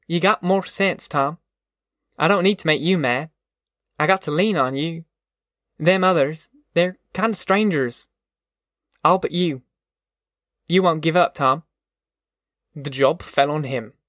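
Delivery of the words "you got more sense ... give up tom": higher-pitched rural mother, earnest with her son; steadfast mother, confiding. Acted. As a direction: lean on him, sincerely.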